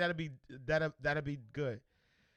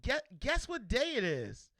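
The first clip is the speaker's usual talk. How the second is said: put-on much higher, slow, sing-song kid voice; daughter voice again; 8 semitones higher, slower than usual. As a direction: tease like a child with a secret. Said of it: "She was like".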